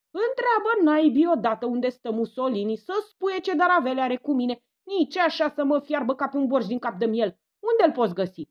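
loud, drowning out everyone; impatient old woman booming; booming demand. A narrator's reading. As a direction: shout over the whole room. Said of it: "Coralia bubuie, acoperindu-i pe toți".

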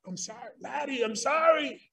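mock flustered apology, Pastor Laddie called out; a sheepish person backpedalling after cursing; embarrassed apology. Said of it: "they utter a curse word and say".

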